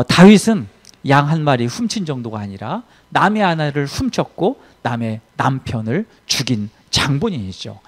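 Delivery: ordinary talk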